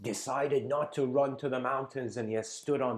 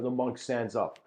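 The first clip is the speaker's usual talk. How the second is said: ordinary delivery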